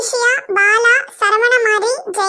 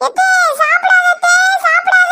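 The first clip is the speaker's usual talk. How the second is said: urgent calling out; 4 semitones higher than usual. Acted. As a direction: cry out to stop her. Urgently stops aunt from eating. (பதற்றத்தோடு கூவுதல்)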